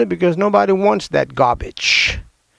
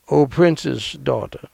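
scripture reading; 4 semitones lower, 5 dB quieter than usual. Acted. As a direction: savor each line of the verse. Reads Song of Solomon aloud.

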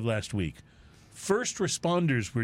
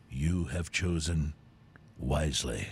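much lower, slow, soft solemn knight; Grail Knight; 12 semitones lower, 5 dB quieter, slower than usual. Retold or imitated. Host quotes the Grail Knight to listeners, deep and solemn. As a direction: intone it like the ancient knight. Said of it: "words of the Grail Knight".

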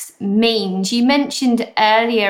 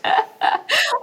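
high laugh. Real, not acted. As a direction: burst of high-pitched laughter. Reacts to teasing.

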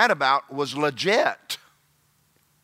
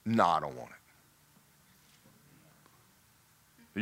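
sulky refusal; 8 semitones lower, 6 dB quieter than usual. Retold or imitated. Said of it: "And you go".

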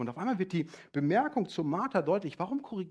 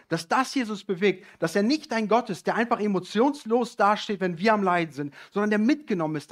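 loud, higher, passionate preaching; preaching climax; 6 semitones higher, 9 dB louder than usual. Acted. Preacher stirring the congregation with loud fervor. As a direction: drive the point home at full force.

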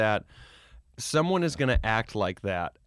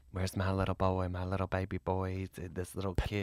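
softer, lower doting mother voice; creepy mother impression; 3 semitones lower, 6 dB quieter than usual. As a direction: croon it like a clingy, creepy mom. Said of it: "you creep me out when you do a voice so perfect".

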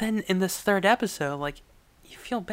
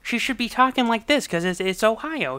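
higher-pitched Cleveland accent; 7 semitones higher than usual. Shown as a demonstration. Showing how she should talk in Ohio, Cleveland accent. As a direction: put on an Ohio, Cleveland twang. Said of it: "have that Cleveland accent".

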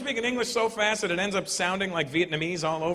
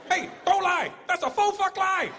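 high shrill-sounding accused voice, much higher; mock scolding; 14 semitones higher than usual. Comedian acting someone accusing a liar, much higher voice. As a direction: scold someone for a faux fuck lie.

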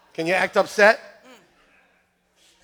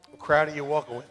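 low, hushed question; soft repeat; 8 semitones lower, 8 dB quieter, faster than usual. Real, not acted. Preacher asking listeners which crowd they walk with, soft and low. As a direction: ask it softly so it sinks in.

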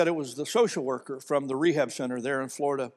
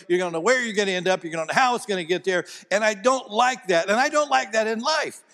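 normal talking voice

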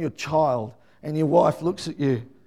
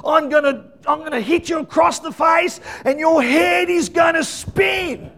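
high, raised voice of a scolding father; threatening dad; 9 semitones higher, 3 dB louder than usual. Retold or imitated. Son mimicking dad's warning when they got in trouble, high threatening voice. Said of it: "my brother and I used to almost mimic him".

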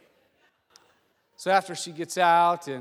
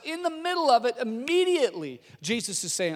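normal talking voice

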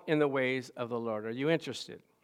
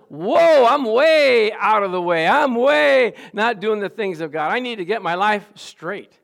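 loud, high and slow whoa of sudden remorse; repentant realization; 6 semitones higher, 10 dB louder, slower than usual. Shown as a demonstration. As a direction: cry out like a man waking to his sins. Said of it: "The proper response is".

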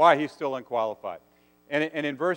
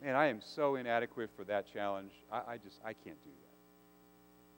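inadequate, fading to whisper; 6 semitones lower, 11 dB quieter than usual. Retold or imitated. Believer doubting a calling, trailing into a whisper. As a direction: confess you're not up to it, voice dying away. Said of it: "is it feelings of".

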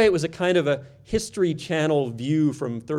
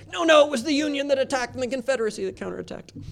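higher, fast know-it-all; correcting audience member; 11 semitones higher, faster than usual. Retold or imitated. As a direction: rattle off the correction, pitched up. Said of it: "some of you are in the audience saying".

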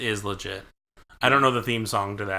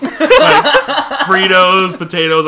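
excited; 6 semitones higher, 9 dB louder, slower than usual. Real, not acted. Joking list, laughing.

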